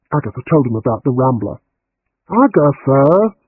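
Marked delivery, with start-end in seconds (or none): 2.3-3.4 drawn-out slow exasperated call of her name; a policeman fed up with her. A narrator's reading. Detective Bill exasperated with Agatha's forgetting, slow drawn-out reproach. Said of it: "Bill sounded exasperated".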